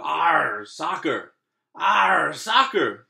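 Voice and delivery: loud pirate crew cheer; pirate crew yell. Acted. The pirate crew yelling at the ball, loud. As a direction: bellow it like a rowdy crew. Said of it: "the crew yelled".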